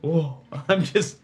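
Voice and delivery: ordinary delivery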